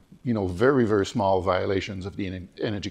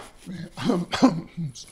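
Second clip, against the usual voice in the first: dry throat cough; hoarse cough; 9 semitones higher than usual. Real, not acted. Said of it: "Excuse me".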